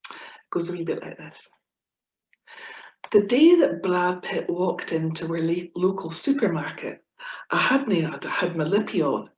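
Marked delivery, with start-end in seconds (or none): 3.1-9.3 lively comic verse reading; poem recitation. Acted. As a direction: perform the poem with gusto. Reciting her comic Scots poem.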